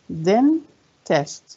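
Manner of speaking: "dentist" split as den, pause, tist; syllable by syllable. Shown as a demonstration. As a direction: count out both parts of "dentist". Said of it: "كم عدد المقاطع".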